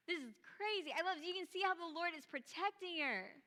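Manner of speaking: ordinary talk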